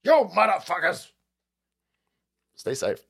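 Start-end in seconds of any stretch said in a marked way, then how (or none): 0.0-1.1 mock road rage. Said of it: "every time I go through Livermore, like".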